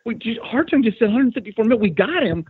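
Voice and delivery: high, excited rush of words; thrilled retelling. Retold or imitated. Telling the co-host about the text, thrilled. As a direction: relive the shock of the text. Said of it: "Well, I was like".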